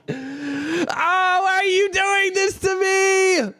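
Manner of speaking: slow high wailing plea; mock anguished cry. Music moves him, mock anguish.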